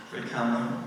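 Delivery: normal talking voice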